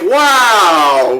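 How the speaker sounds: mock-amazed, drawn-out reaction; retold astonishment. Friend reacting to a crude joke, retold astonishment.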